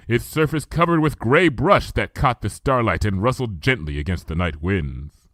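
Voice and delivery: ordinary delivery, nothing marked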